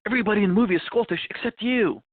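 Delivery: brisk, high-pitched director pitching a role. Retold or imitated. Director briefing Sean on a Scottish film. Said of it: "the director comes to him and goes".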